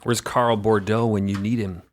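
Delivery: usual speaking voice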